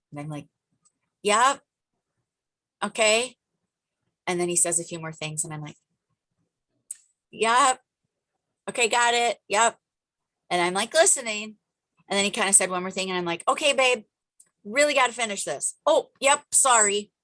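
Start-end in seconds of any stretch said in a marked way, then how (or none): 1.2-9.8 high, loud quick yeps; brisk brush-off. Retold or imitated. Brushing off husband while busy. 13.5-17.1 high, loud, hurried "okay babe"; hurried apology. Retold or imitated. Gently rushing husband to finish.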